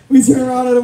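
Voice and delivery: ordinary talk, nothing marked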